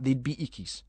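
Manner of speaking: usual speaking voice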